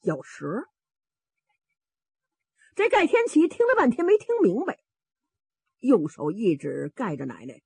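ordinary delivery, nothing marked

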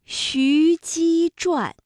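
徐姬传 very slow and high, each syllable held; pronunciation demo. Shown as a demonstration. Teacher breaks down a hard title, slow careful demonstration. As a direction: stretch out 徐姬传 syllable by syllable for learners. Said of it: "这都是舌面音连读".

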